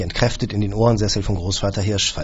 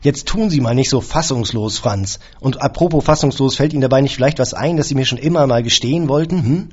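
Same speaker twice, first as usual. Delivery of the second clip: loud, higher, biting sarcasm; hard and snippy; 5 semitones higher, 5 dB louder than usual. A narrator's reading. Employer snapping accusations at his caretaker, loud and cutting. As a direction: needle him hard and sharp. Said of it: "Meine Stimme war hart und schnippisch".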